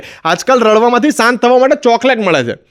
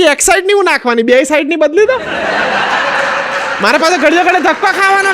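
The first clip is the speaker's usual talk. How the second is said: higher, fussy lady voice; lady customer; 7 semitones higher than usual. Retold or imitated. Woman telling the mechanic to change both batteries, high and fussy. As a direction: complain like a fed-up aunty.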